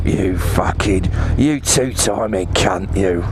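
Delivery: put-on low, slow jilted growl of the old bike; bike Dave cursing. Old bike Dave cursing its cheating owner, low and slow.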